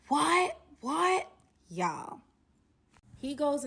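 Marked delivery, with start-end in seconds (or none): 0.1-1.3 high, loud mock yell of what; imitated angry scream. Pissed-off boyfriend screaming at the bad news, loud mock yell. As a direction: act out his furious disbelief. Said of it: "he's just screaming and he's pissed off".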